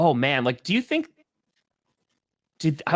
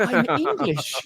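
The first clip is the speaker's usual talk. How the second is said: playfully faked English accent; playing at being English; mock English accent; 5 semitones higher than usual. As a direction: put on a British accent. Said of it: "now talk like a British person".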